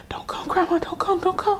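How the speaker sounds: high, whispery pleading to oneself; retold frightened inner voice, high and whispery; urgent silent plea. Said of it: "I was saying to myself".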